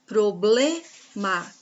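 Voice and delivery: "Problema" very slowly, pro-BLE-ma; syllable-by-syllable. Shown as a demonstration. Teacher pointing out the penultimate syllable, "Problema" split slowly. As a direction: hold the BLE in "Problema", pause before "ma". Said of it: "é a penúltima sílaba da palavra".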